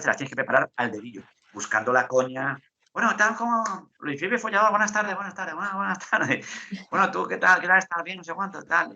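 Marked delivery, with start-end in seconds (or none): 2.9-8.9 higher-pitched mock show greeting; radio interviewer greeting. Host greeting the futsal player for laughs, raised presenter voice. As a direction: breeze through repeated hellos in a raised voice.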